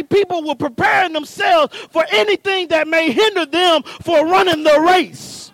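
fervent pulpit call; preaching at full force. Acted. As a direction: push the congregation to run the race. Preaching builds again.

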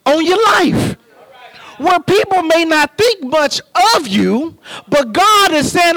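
fervent pulpit delivery; preaching at full force. Acted. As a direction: drive it home to the congregation. Preaching climax.

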